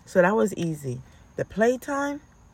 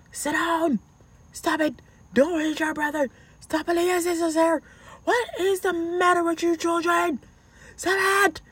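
retold screaming at kids; 11 semitones higher than usual. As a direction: scream it like a fed-up mother. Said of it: "I was screaming on top of my lungs".

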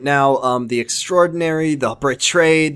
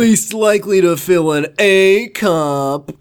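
mock award announcer; 5 semitones higher, 4 dB louder, slower than usual.

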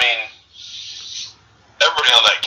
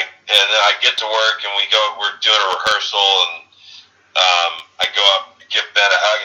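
normal talking voice

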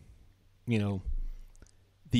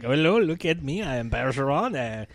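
put-on French Canadian accent; hammed-up French Canadian in broken English; Patrice Bergeron; 4 dB quieter than usual. Hockey star boasting to fans, mock French Canadian accent. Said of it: "He doesn't have a Canadian accent".

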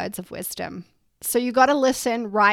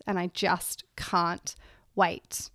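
usual speaking voice

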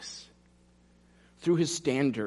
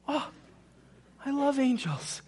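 naive gushing; 12 semitones higher than usual. Retold or imitated. Mocking a naive churchgoer.

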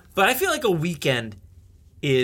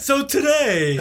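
mock teacher; 9 semitones higher, 3 dB louder, slower than usual. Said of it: "I gotta attempt this".

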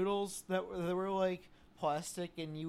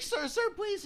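much higher mock plea; pleading woman; 4 dB louder than usual. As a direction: squeak out a desperate beg. Quoting homeless woman pleading.

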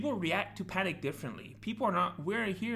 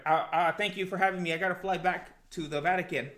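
normal talking voice

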